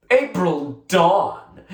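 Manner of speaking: slow, drawn-out and a little grand; offhand correction. A narrator's reading. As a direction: she corrects Melanie casually, savouring her own name. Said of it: "April corrected with an offhand sort of smile".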